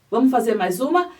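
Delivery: ordinary talk, nothing marked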